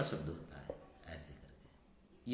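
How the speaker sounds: whispered aside. Real, not acted. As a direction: whisper it to yourself while writing. Aside while writing. (धीमी फुसफुसाहट में, धीरे से)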